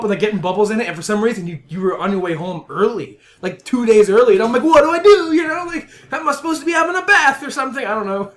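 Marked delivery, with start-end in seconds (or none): 4.6-7.8 high, loud panicked self-quote; hammed-up alarm at being caught; mock panic. Panicked dreamer quoting himself.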